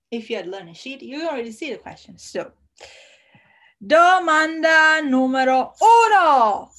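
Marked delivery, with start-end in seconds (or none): 3.8-6.7 announcer shout. Acted. Quiz announcement.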